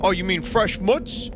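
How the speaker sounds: deli guy impression. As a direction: imitate a deli guy checking the order, slow and high. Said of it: "He goes".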